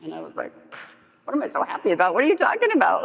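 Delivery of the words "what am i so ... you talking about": indignant disbelief, leaping much higher; incredulous echo. Retold or imitated. Dealer answering a coworker who questions her happiness, incredulous. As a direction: throw the question back in mock outrage.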